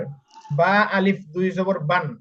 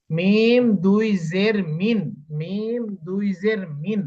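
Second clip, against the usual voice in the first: slow, long-held vowels in each letter name; drawn-out spelling drill; much slower than usual. Shown as a demonstration. Teacher modelling how to spell out min, slow drawn-out drill. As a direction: stretch out each syllable for learners.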